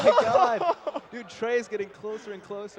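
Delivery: loud high hype call; esports caster. Real, not acted. Caster calls the tight race.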